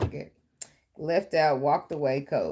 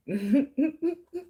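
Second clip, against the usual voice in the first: squealing burst of laughter; bright giggle at her own joke; high laugh; 13 semitones higher, 4 dB louder than usual. Real, not acted. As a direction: crack up high. Laughing at herself.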